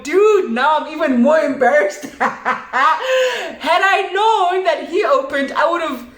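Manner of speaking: high, laughing through the words; real giggly, high-pitched embarrassment; embarrassed laughing. Real, not acted. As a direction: laugh helplessly at your own blunder. Laughing at her own embarrassment.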